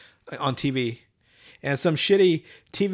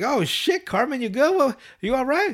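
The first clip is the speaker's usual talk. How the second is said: put-on high, worried kid voice; 9 semitones higher than usual. Kids checking on Cartman after he falls, high. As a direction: check on a buddy in a squeaky voice.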